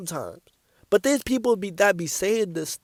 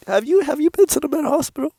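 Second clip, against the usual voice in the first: fast, higher, louder mocking question; incredulous roast; 7 semitones higher, 4 dB louder, faster than usual. Real, not acted. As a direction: fire it off in stunned disbelief. Same mock confrontation, leaning in.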